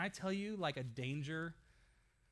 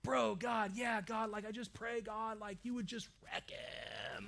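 lightly voiced stoked surfer praying; surfer bro prayer; 4 semitones higher, slower than usual. Pastor imitating the surfer's prayer, lightly voiced. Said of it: "I love his prayers 'cause it was like".

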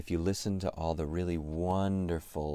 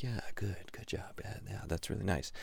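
soft whispered praise; whispering; 2 semitones higher, 6 dB quieter than usual. Retold or imitated. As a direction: whisper encouragement to a singer. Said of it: "unvoiced whisper".

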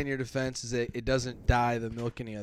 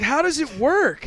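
loud, high, drawn-out disbelief; amazed question; 11 semitones higher, 8 dB louder than usual. Retold or imitated. Asking a friend about his refund trick, amazed. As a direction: ask it incredulously, stretching the words.

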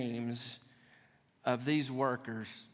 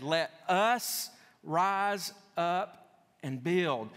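"us" drawn out, the whole phrase slow; stress on us; 3 semitones higher, 3 dB louder, much slower than usual. Shown as a demonstration. Preacher reads the people's reply to the congregation, stressing "us". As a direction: lean long and hard on "us", then measured words. Stressing the changed pronoun to plural.

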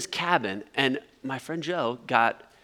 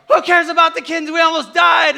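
high, loud, fast retort; exasperated yelling; 12 semitones higher, 10 dB louder than usual. Retold or imitated. Passenger answering the panic over kittens, exasperated yelling. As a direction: yell back in frantic disbelief.

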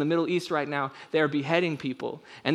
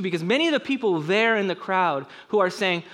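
ordinary delivery

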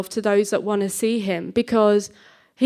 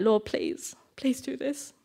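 inward pleading; 3 semitones higher, 9 dB quieter, slower than usual. Retold or imitated. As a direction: plead under your breath, acting out inner worry. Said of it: "so inside I'm like".

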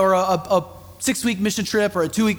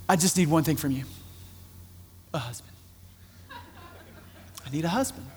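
hushed pleading prayer; 3 semitones lower, 10 dB quieter than usual. Retold or imitated. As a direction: beg God quietly for a husband, half laughing. Young woman begs God for husband.

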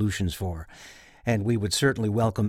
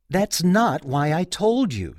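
slow, higher, mildly exasperated moan; 7 semitones higher, slower than usual. Retold or imitated. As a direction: draw it out like a mock complaint. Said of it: "she moaned".

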